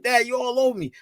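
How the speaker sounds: high whiny daughter voice; high mock-whine of a daughter; daughter's whine. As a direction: mimic his grown daughter brushing him off. Daughter voiced in father's story.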